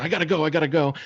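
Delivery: put-on high, rushed little-kid panic; imitating a frantic little girl; panicked kid repeating. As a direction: repeat it frantically like a four-year-old. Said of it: "She's telling her cousin".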